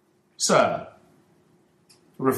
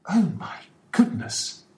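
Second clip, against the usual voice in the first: much higher gasping exclamation; shocked exclamation; 12 semitones higher than usual. A narrator's reading. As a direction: blurt it out in shock. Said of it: "exclaimed Mister Ferguson".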